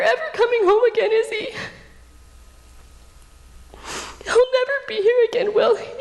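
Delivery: high, breaking voice with crying laughs; tearful prayer. Retold or imitated. Widow asking God if her husband returns, crying. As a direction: sob it out to God, half laughing through tears. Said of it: "that I wept for the first time".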